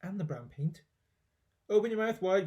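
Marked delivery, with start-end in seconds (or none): 1.7-2.4 put-on coaxing boy. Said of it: "he said".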